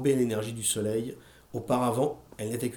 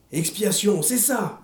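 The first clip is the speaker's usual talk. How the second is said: cri aigu qui monte sur c'est ça; exaltée, hors d'elle; hurlé en délire; 6 semitones higher, slower than usual. A narrator's reading. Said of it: "hurla-t-elle en délire". (Madame Carmody hurle en délire)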